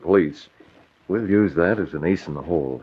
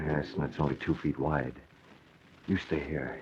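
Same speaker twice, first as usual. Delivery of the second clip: hushed order, much softer; whisper; 3 semitones lower, 12 dB quieter than usual. Acted. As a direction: whisper the plan. Sneaking beside Amelia's flat.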